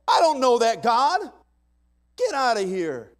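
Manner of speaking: high, loud scornful shout; defiant Pharaoh. Acted. Pharaoh to Moses, loud scornful shouting. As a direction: bark back like a proud king. Pharaoh defying God in sermon.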